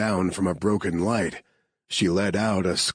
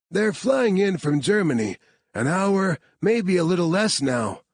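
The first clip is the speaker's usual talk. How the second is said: a higher, lightly voiced young man; young tech guy; 11 semitones higher than usual. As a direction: give her the facts, a bit nervous. Harvard answers Claire.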